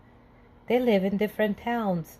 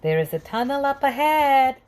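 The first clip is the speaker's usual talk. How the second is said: higher, louder news of the tunnel; excited announcement; 6 semitones higher, 5 dB louder than usual. A narrator's reading. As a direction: build up the thrill of the tunnel.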